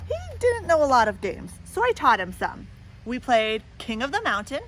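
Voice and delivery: storybook read-aloud voice; lively picture-book reading; story narration. A narrator's reading. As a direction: tell it to a child at bedtime.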